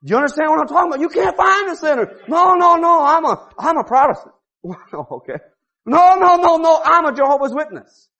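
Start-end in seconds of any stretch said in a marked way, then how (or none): 2.3-8.1 mock protest. Mocking self-righteous believers.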